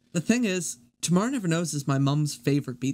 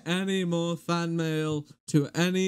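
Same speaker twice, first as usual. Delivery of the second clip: memorised video recital. Acted. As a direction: deliver it as a ponderous public announcement. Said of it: "I've memorized this".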